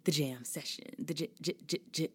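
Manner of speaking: record-scratch stutter on the j; DJ stutter intro. Pretend. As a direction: play it like a DJ cutting up the title. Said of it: "don't call me [name]".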